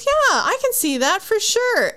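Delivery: high, sing-song yes-man voice; mock eager agreement. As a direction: parrot the boss like a brownnoser. Imitating agreeable meeting colleagues.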